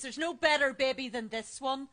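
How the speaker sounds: ordinary talk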